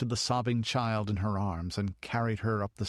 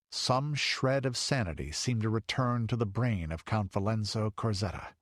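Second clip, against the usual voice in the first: ordinary delivery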